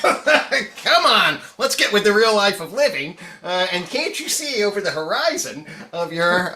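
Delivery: high-pitched, playful goading; mock exhortation. Host jokingly goading scientists past their skepticism, mock exhortation. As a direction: cry out in pretend exasperation at the sceptics.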